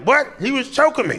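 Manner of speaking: high-pitched outraged protest; high indignant roommate. Retold or imitated. Roommate protests to the cop, high and indignant. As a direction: squeal the accusation, high.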